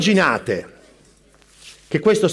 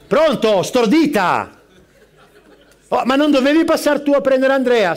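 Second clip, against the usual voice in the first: irritated scolding; 5 semitones higher, 4 dB louder than usual. Acted. Scolding his wife on the phone.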